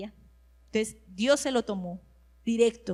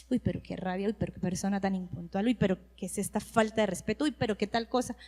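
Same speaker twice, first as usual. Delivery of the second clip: soft, lower grumbling "uy, pero qué rabia"; inner grumbling; 5 semitones lower, 4 dB quieter than usual. Retold or imitated. Preacher retelling her own inner complaints, low grumbling. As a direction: mutter your annoyance under your breath. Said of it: "lo que por dentro de mí pasaba".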